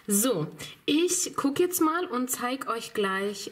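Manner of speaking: ordinary talk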